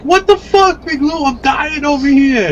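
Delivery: high, squeaky mock voice of a friend gasping; mock choking friend. Friend after six hits off the box, squeaky and high. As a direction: ham up a coughing buddy overwhelmed by the hits. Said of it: "And he was like".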